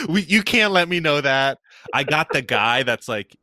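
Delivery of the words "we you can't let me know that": high, put-out protest at the phone; mock indignant. Retelling her phone screen time, mock offended protest. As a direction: act offended that the phone tells you.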